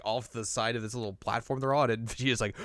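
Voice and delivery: ordinary talk, nothing marked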